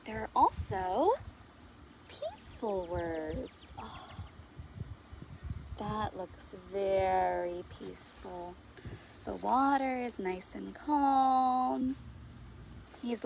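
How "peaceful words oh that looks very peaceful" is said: lower, softer and slow; soothing. Acted. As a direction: let the word peaceful calm them. Reading about peaceful words.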